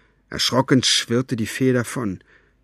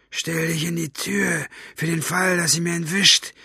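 commanding; 7 semitones higher than usual. A narrator's reading. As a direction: instruct an underling with menace. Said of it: "befahl er dem Wächter".

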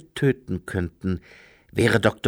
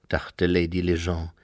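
usual speaking voice

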